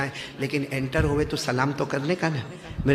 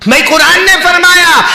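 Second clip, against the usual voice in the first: full-force preaching, higher and louder; 14 semitones higher, 19 dB louder than usual. Acted. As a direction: drive the command home to the crowd. (پورے زور سے وعظ)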